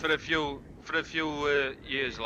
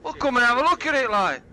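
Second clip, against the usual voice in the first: loud, fast come-and-look call; 7 semitones higher, 8 dB louder, faster than usual. Retold or imitated. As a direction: call him over loud and quick. Garage seller calling out, loud.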